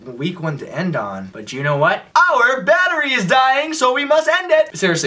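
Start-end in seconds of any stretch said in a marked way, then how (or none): 2.1-4.8 high, slow declaimed warning; hammed-up, drawn-out announcement; mock announcement. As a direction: proclaim it slowly in a high put-on voice. Mock announcement to close the video.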